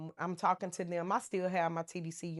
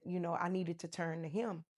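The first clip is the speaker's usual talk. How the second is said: normal talking voice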